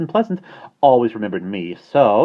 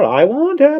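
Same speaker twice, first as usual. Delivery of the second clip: more insistent; 12 dB louder than usual. Acted. Repeated, rising insistence.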